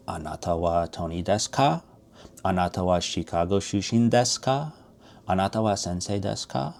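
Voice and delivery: Anata wa Tony desu ka rising on ka; question intonation. Shown as a demonstration. Teacher modeling Japanese questions with rising ka. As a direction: let ka lift each line into a question. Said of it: "Question for you".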